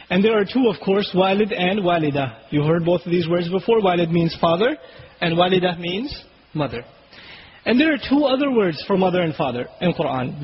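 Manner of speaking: ordinary talk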